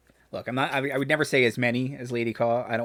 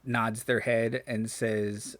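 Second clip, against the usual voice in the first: ordinary talk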